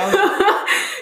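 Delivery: words through laughter; giggling while talking; laughing. Real, not acted. Laughing at cracked voice.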